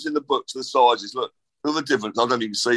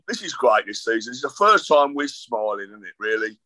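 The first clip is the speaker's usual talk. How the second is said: normal talking voice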